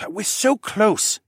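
high, drawn-out protest; urgent, high-pitched argument; arguing. A narrator's reading. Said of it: "he argued".

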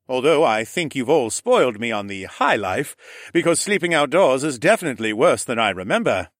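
a lightly voiced roguish uncle; Horus's voice. A narrator's reading. Horus cheering up his sick nephew, lightly teasing. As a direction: tease the boy kindly.

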